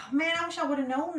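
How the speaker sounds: high, slow amazed exclamation; real surprise. Real, not acted. Answering a viewer's tip about Flamingo tigers, high and amazed. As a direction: sound truly amazed.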